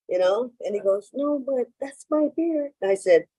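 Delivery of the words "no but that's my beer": high, insistent stranger repeating his claim; insisting stranger. Retold or imitated. Man at the bar insists again, high and firm. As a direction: press the point, pitched up.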